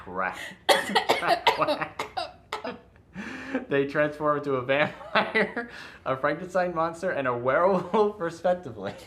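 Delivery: words broken by giggles; can barely read for laughing; laughing while reading. Real, not acted. Reading the premise, cracking up.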